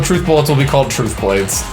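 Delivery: usual speaking voice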